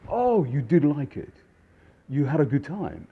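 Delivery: high, loud, mock-astonished questioning; feigned surprise. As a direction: play pleasantly taken aback. Waiter feigns surprise to guests.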